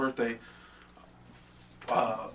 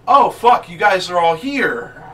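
loud, high burst of surprise; retold startled outcry; 4 semitones higher, 12 dB louder, slower than usual. Storyteller finding the friends down the block, acted surprise. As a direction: act out stumbling on everyone, loudly.

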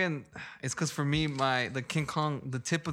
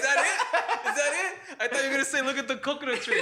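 high-pitched, talking through laughter; laughing hard; 10 semitones higher than usual. Real, not acted. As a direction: crack up while getting the line out. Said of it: "I'm laughing so hard".

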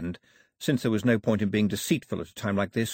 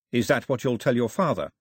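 ordinary delivery

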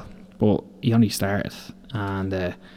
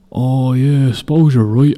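dopey apprentice voice; 3 semitones higher, 5 dB louder than usual. Retold or imitated. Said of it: "And he goes".

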